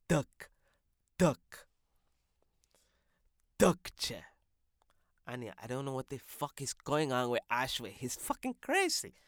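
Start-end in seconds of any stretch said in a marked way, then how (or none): none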